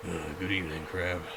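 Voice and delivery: low, soft polite greeting; low gentle voice. Acted. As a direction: greet the crab low and calm.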